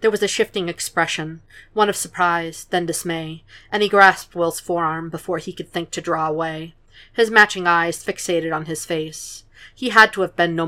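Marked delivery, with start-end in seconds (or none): none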